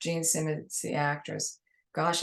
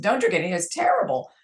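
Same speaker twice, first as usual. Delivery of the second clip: put-on louder blunt New York accent; 3 semitones higher, 5 dB louder, faster than usual. New York accent telling the actress the coffee is terrible. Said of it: "with my New York accent".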